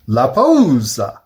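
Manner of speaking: mock Spanish pause.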